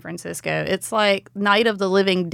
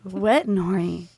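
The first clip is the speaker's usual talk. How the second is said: phone sex line voice; 2 semitones lower, 3 dB quieter than usual. Pretend. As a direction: purr it like a sex hotline ad. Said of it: "I can do voiceovers as well".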